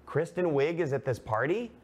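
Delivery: high, disbelieving question; surprised. Retold or imitated. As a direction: ask it in disbelief. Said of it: "And I was like".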